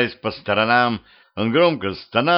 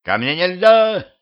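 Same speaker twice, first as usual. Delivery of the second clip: high, loud, drawn-out shout; indignant yell with stretched words; 10 semitones higher, 5 dB louder than usual. A narrator's reading. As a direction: holler a warning to keep out. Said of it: "заорал депутат".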